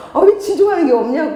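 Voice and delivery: high, loud acted exasperation; exasperated mother. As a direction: demand it in mock frustration. Acts exasperated mother, repeated more forcefully.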